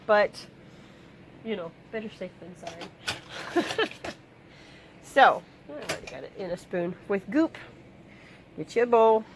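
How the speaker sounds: talking around a piece of gum; chewing gum while talking. Real, not acted. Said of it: "Sorry, I'm chewing gum".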